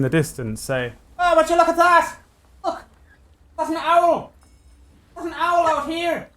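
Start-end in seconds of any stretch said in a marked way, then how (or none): 1.2-6.3 very high, shouted cry from aloft; crow's nest lookout. Acted. Fellow high in the crow's nest to those on deck, high-pitched cry out. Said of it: "there is a sudden cry out".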